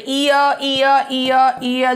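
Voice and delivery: "ईयर" repeated as a vowel-sound demo. Shown as a demonstration. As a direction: chant "ईयर" over and over to show the shared sound. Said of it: "यहाँ पर repeat हो रहा है". ("ईयर" की दोहराई गई स्वर ध्वनि)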